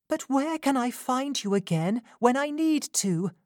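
pleading Rose. A narrator's reading. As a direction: ask her not to go back. Rose asking.

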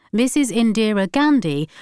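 ordinary delivery, nothing marked

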